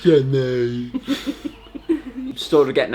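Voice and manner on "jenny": drawn-out imitation of the film hero; Forrest Gump. Co-host echoing the tease about Jenny, film impression. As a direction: stretch the name in film-hero voice. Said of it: "You know, from Forrest Gump".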